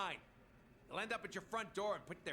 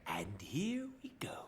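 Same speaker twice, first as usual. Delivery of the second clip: whisper; 7 semitones lower, slower than usual. Real, not acted. Tense moment before boss kill.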